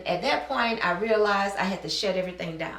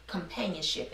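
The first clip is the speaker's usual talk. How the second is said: a quiet, lower voice lightly imitating a mysterious message; 3 semitones lower, 9 dB quieter than usual. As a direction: softly drop in the one word as an unseen voice. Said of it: "something just said".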